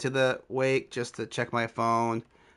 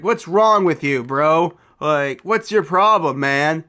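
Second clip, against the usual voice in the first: loud, raised, confrontational yell; heated mock scream, pitched up; screaming at someone; 3 semitones higher, 7 dB louder, slower than usual. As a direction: imitate an angry friend yelling accusations. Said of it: "screaming at me even more".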